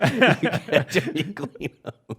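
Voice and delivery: slow high laughing echo. Retold or imitated. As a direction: draw it out slowly while laughing. Echoing saying, slow laughing.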